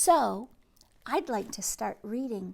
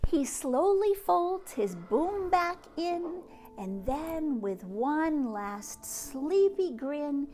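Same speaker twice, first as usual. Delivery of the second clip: lively sing-song picture book reading; reading to children; 4 semitones higher, 3 dB louder, slower than usual. Acted. Teacher reading a bedtime book to kids. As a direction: read it out to little ones with bounce.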